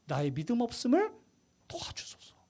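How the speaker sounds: fading into a whisper; hushed plea. Retold or imitated. The father begging for help with his unbelief, whispering. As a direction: breathe the plea out, barely voiced. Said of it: "뭐라고 부탁해요".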